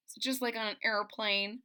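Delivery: ordinary delivery, nothing marked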